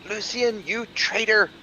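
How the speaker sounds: high, furious cry of betrayal; angry accusation. Acted. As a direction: hurl it at the traitor. Betrayed, shouting after Lucian.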